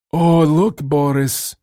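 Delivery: high, hammy fake Russian accent. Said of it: "with a heavy and very fake Russian accent".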